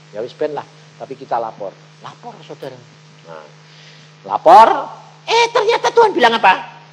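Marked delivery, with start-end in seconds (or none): none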